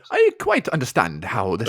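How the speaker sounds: put-on posh English accent; mock a well-spoken posh English leading man. Friend joining in the mimicry of the lead, posh English accent. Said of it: "is very posh".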